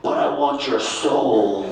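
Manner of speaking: slow, low sinister wife voice; menacing wife. Retold or imitated. Wife claiming husband's soul, slow and sinister. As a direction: draw it out like a demon.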